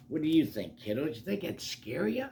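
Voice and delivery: usual speaking voice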